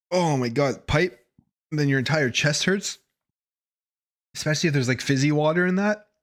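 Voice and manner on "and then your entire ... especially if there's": ordinary talk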